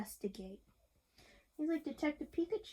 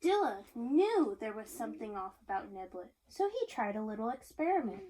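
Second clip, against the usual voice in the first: plain reading voice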